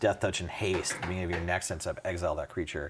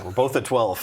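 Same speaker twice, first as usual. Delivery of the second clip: ordinary delivery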